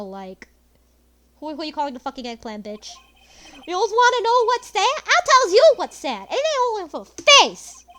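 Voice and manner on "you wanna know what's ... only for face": loud, higher, hammed-up sneer; loud mocking gangster voice; sneering thug. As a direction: gloat over her like a cartoon goon. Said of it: "that's the way I said it, though".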